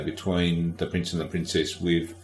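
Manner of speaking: ordinary talk, nothing marked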